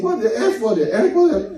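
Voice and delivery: teriakan marah pura-pura yang naik tinggi, ulangi "What the F" tiga kali; mock angry yell. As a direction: tirukan orang yang marah-marah di mesin ATM dengan suara tinggi. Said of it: "he kept saying it".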